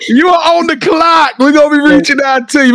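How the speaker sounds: high, hyped-up shouting; excited shout. Real, not acted. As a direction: shout it out to the next guest. Host hyping the next guest.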